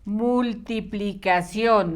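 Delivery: Multiplicación slowly, every syllable distinct; measured Multiplicación, no sound left out; careful slow dictation. Shown as a demonstration. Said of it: "No te vaya a faltar ninguna letra".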